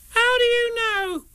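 very high, slow, indignant little Roo. A narrator's reading. Roo challenges Rabbit about his cough, indignant squeak. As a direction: protest in a squeaky, drawn-out child's voice. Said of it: "asked Roo indignantly".

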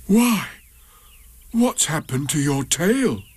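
startled bear noticing something missing; surprised. A narrator's reading. Pooh notices Eeyore's missing tail, startled and high. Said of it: "he said in surprise".

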